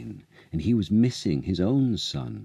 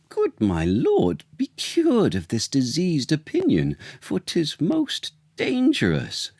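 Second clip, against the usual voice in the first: alarmed cry; 10 semitones higher than usual. A narrator's reading. Adviser pleading with the king, high alarmed cry. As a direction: plead urgently, climbing in pitch. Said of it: "Camillo cried".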